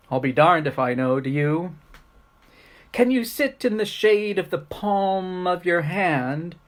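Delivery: playful rhymed questions with lilting pitch; poem recitation. Acted. Teacher reciting a silly poem to kids, performed recitation. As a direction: recite it as a comic poem, stressing the key words.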